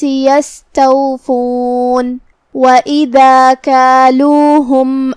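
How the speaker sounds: slow, measured tajweed chant; Quran recitation. Shown as a demonstration. Teacher recites the verse for students, slow tajweed chant. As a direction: recite with each word drawn out. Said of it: "teacher recites the verse".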